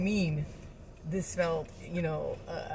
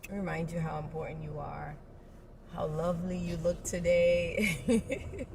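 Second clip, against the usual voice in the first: normal talking voice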